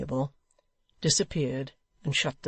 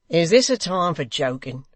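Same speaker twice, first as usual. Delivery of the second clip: louder, angry question; impatient cry; 7 dB louder than usual. Acted. Hugh crying out at the hangman, impatient and loud. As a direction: snap at him. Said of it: "cried Hugh".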